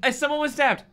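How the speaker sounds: very high eager outburst; squealed interruption; excited interjection. Real, not acted. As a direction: jump in gleefully.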